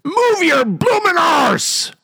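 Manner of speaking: very high, slow Cockney yell at a racehorse; Cockney shout. Retold or imitated. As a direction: let the posh act slip into a shrieking Cockney cheer, drawn out. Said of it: "and shouts at her horse".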